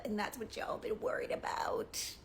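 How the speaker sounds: conspiratorial whisper. Real, not acted. Taunting critics in a sly whisper. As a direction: lean in and whisper it.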